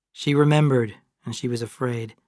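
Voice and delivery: usual speaking voice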